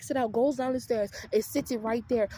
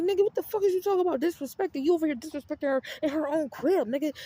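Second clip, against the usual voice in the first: raised, heated comeback at the brother; angry retort; 4 semitones higher, 3 dB louder than usual. Retold or imitated. Sister angrily confronting her brother over mom. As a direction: snap back louder and higher.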